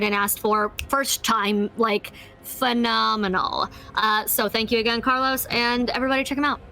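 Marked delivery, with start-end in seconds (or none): none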